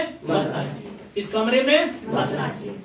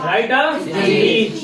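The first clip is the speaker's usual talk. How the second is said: slow model sentence; much slower than usual. Shown as a demonstration. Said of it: "teacher models sentence".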